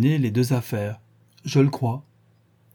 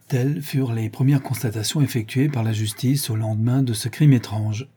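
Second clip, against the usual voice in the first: ordinary delivery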